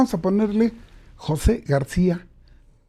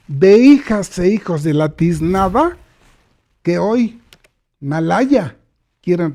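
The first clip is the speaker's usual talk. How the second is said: forceful oratory; 9 dB louder than usual. Real, not acted. Rally speech condemning elites.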